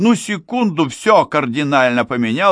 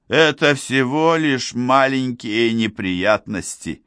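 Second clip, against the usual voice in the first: slow, higher, eerily casual man; disfigured mountaineer, grim calm; 6 semitones higher than usual. A narrator's reading. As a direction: shrug off his wounds, oddly light. Disfigured man voiced by narrator.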